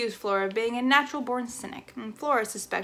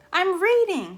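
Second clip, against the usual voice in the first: higher, louder answer down; shouting back; 9 semitones higher, 6 dB louder, slower than usual. Acted. Flora answering her mother downstairs, shouting back. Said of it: "Flora shouted back".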